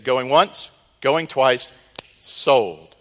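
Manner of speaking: loud auctioneer chant, slamming down on sold; auctioneer call. Acted. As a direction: sell it off like an auctioneer. Auctioneer calls the sale.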